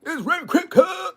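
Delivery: high mock gangster call-out; a hammed-up high "let me see, cuz"; imitating a rival calling out; imitating a gang challenge. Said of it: "It wouldn't have been no".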